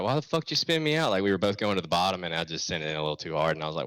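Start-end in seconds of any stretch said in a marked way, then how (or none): 0.0-1.1 raised mock-angry demand, higher pitch; retold angry outburst. Race host snapping at the driver who spun him, mock anger. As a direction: fire it back like an irritated racer. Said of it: "he was like".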